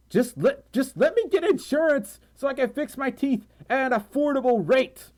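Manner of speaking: high-pitched mock pleading; hammed-up desperate complaint; exasperated plea. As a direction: plead in comic frustration, pitch way up. Mock plea about insurance costs.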